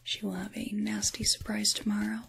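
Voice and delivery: ordinary talk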